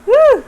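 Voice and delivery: loud, high "woo!" to shake it off; sudden high whoop. Real, not acted. Shakes off her own stumbling.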